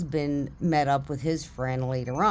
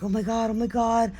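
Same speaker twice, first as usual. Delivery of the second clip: higher-pitched, mildly overwhelmed; overwhelmed self-quote; 6 semitones higher than usual. Retold or imitated. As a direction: retell being overcome after a rescue. Retells panicked relief after rescue.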